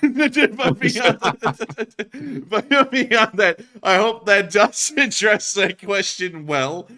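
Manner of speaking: high, loud, laughing words. Real, not acted. Host cracking up after his skit, laughing. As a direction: struggle to speak through your laughter.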